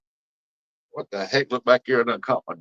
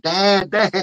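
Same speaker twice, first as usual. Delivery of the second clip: high, loud cry of Dad, Dad; a daughter yelling for her dad; retold scream; 10 semitones higher, 7 dB louder than usual. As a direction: call out Dad like a scared kid. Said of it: "she was screaming".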